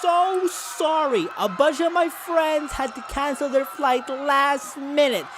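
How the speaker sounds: high, soft, slow role voice; a sorry pilot soothing passengers; apologetic blimp pilot. Acted.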